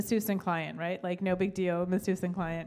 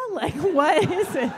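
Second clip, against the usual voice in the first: excited; 9 semitones higher, 8 dB louder than usual. Retold or imitated. Asking about tattoo, excited.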